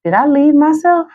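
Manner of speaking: high questioning inner voice; self-questioning. Shown as a demonstration. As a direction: ask it lifted up high in surprise. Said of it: "ask yourself".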